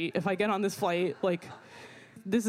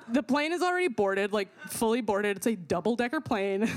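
ordinary talk, nothing marked

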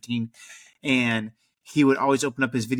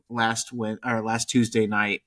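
ordinary talk, nothing marked

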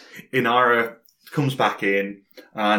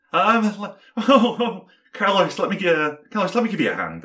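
lightly voiced flustered helper, higher at first; a higher, hesitant character voice; Jim character voice; 8 semitones higher than usual. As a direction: stammer in high, then offer help. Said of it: "Jim legs it back in, and goes".

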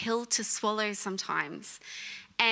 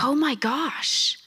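whispered gasp; 4 semitones higher, 3 dB louder, much slower than usual. Retold or imitated. Relieved realization retold.

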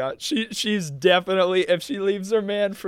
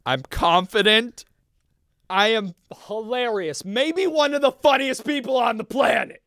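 higher, building self-affirmation; with your chest; 5 semitones higher than usual. Shown as a demonstration. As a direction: declare it boldly, pitch climbing as you go. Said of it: "Say it with your chest".

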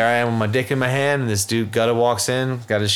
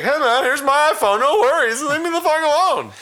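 high nervous pleading; 12 semitones higher than usual. Retold or imitated. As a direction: hand it over fast, scared. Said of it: "we're like".

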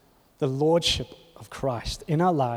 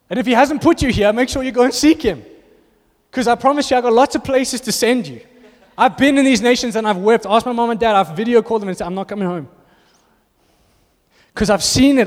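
raised, higher and louder sermon push; impassioned preaching; 6 semitones higher, 5 dB louder than usual. Acted. Pastor urging the congregation to go, full-force preaching. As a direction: preach it hard, urging them to seek God. Preacher pleading to go seek God.

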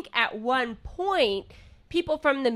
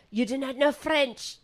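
put-on snooty French accent; 4 semitones higher than usual. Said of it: "don't have the proper French accent".

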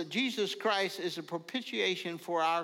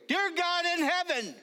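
high, loud start of a prayer; praying out loud; 13 semitones higher, 5 dB louder than usual. Retold or imitated. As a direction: lead the prayer aloud, high and strong. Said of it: "And so I began to pray".

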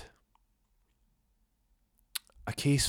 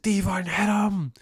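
much higher, louder, drawn-out yell; urgent shout; 12 semitones higher, 6 dB louder than usual. Retold or imitated. Cooper yelling at Devon to strike, high and loud. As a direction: scream at him to strike.

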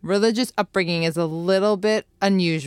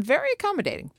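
high-pitched aside; 12 semitones higher, much faster than usual. Acted. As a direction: pitch leaping way up. Same aside said twice, pitched up.

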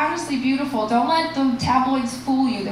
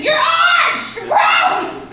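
very high, loud protest scream; imitated screaming; 7 dB louder, much slower than usual. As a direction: scream it up at a bedroom window.

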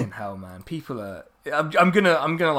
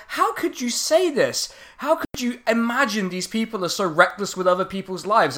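hammed-up outrage; 7 semitones higher than usual. Mocking the upset critic again, hammed-up high outrage. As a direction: overplay a shocked, pearl-clutching critic. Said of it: "they were like".

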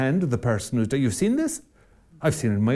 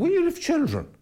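mock cheerful answer. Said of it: "what'll happen then".

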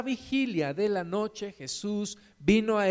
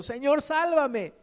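slow, high-pitched plea for rescue; narrated drowning man's cry; 8 semitones higher, 3 dB louder than usual. A narrator's reading. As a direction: call out for help, drawing it out. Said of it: "dio voces diciendo".

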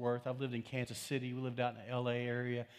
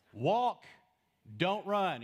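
loud, higher lifeguard command; raised-voice pool warning; lifeguard yell; 6 semitones higher, 5 dB louder, much slower than usual. Acted. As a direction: call it out like a lifeguard at swimmers. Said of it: "ever been yelled at by a lifeguard".

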